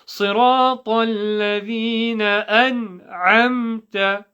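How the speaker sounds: careful Quranic recitation; crystal-clear recitation. Shown as a demonstration. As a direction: recite with long vowels, every letter heard. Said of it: "is crystal clear".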